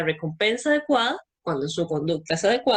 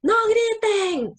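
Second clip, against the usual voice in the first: much higher, drawn-out mock shout of "¡No griten!"; mock teacher yell; 13 semitones higher, 3 dB louder, much slower than usual. Teacher yelling at noisy pupils to stop shouting, mock high shout. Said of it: "cuando una maestra o un maestro dicen".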